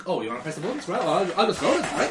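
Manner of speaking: mock passive-aggressive grumbling. Voicing a crewman handing over the job, passive aggressive. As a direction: act huffily compliant, half laughing. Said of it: "He's, like, really passive aggressive".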